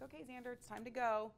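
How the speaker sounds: airy, offhand and upbeat; casual. Retold or imitated. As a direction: breezily tell a kid it's time to leave. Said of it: "very kind of casually".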